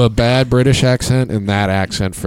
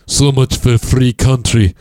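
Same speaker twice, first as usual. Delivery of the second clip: lowered voice doing a Russian mom; Russian accent; 3 semitones higher, 3 dB louder, slower than usual. Retold or imitated. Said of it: "deepen your voice to do it".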